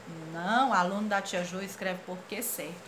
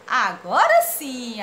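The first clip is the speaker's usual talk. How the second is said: slow loud approval; 9 dB louder, much slower than usual. Retold or imitated. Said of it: "Aí ele diz assim".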